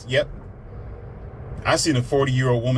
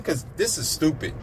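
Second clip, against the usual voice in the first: ordinary talk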